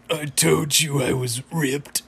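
slow, put-on dying cartoon voice; Muscle Man. Skinned Muscle Man's last words before falling over, slow and hammed-up. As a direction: drag out the last boast in character. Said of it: "he's standing there skinned".

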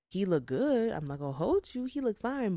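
playful admiring gush. Retold or imitated. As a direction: gush over how fine he looked. Gushing about a handsome man.

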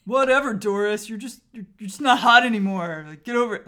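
dismissive bro, loud and careless; lightly voiced bro brushing her off. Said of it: "Is that, is that your bro voice?".

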